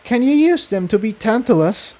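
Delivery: put-on high-pitched worried young pony; Tiptoe voice. A narrator's reading.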